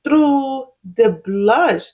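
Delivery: slow, savoring each word; slow and deliberate. Shown as a demonstration. Vlogger showing viewers her makeup trick, slowly and deliberately. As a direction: draw it out like you're admiring the glow. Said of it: "Let me show you what I'm talking about".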